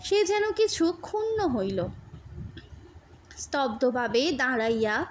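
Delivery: ordinary delivery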